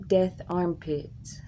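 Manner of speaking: ordinary delivery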